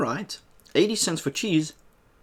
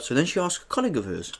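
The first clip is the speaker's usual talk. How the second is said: usual speaking voice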